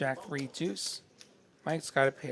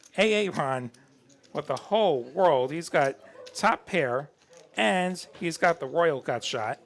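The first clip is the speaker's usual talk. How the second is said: excited call; 3 semitones higher, 7 dB louder, slower than usual. Real, not acted. Caller hyping a big flop.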